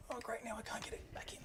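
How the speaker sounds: unvoiced frustrated whisper. Real, not acted. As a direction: whisper it under your breath. Muttering at stuck cable.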